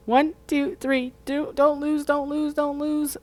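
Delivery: high, urgent pleading; tense pleading. Real, not acted. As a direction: beg the machine not to drop. Coaxing the device to connect.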